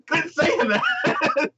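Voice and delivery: 웃음 섞어 천천히 말하기; 웃음을 참지 못하고 늘어지게; laughing. Real, not acted. Laughing at repeated losing line.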